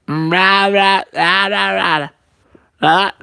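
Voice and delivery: put-on loud baby-creature babble; hammed-up babbling outburst; Jack Jack. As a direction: yell nonsense rahs in a put-on voice. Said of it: "I tried to do Jack Jack".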